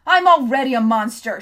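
very loud shriek. A narrator's reading. Hester shrieks at Tom, loud shriek. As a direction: shriek it back at him. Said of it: "she shrieked".

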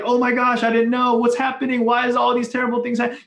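high, fast mock panic; panicked God. Preacher mocking a panicking God, high and frantic. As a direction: ham up a flustered freak-out. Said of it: "He's not like, he's not like".

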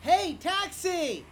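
slow, high calling shout; hailing a taxi. Acted. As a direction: stretch it out to flag a cab. Hailing a cab on street.

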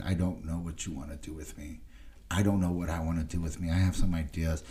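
low, subdued retelling of a prayer; retold prayer. Man recounting his desperate prayer to God, quiet and low. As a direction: quietly own up to being lost. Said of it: "I pray to God, and I said".

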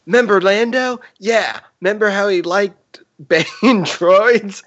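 high, sing-song grape voice, stifling a laugh; Member Berries voice. Retold or imitated. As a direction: bounce it up high, almost cracking up. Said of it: "Try it again".

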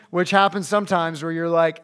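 ordinary delivery

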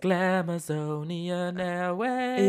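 stretched, slow showy announcement; sing-song Glamazonian Airways call; drag queen airline announcement. Retold or imitated. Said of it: "in der sechsten oder siebten Staffel von RuPaul's Drag Race".

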